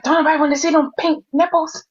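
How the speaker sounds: high, quick mocking quote; mimicking a remark. Mimicking someone's remark in a recap.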